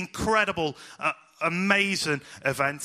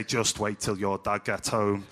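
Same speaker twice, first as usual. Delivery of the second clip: low, slow mock threat from mum; lightly voiced mum, drawn out; a hint of a warning mum; 8 semitones lower, slower than usual. Mum warning her child, lightly voiced slow threat. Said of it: "my mum used to say".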